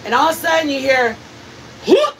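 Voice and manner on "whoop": imitated whoop. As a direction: mimic the thud-like cry from outside. Imitating sound heard outside.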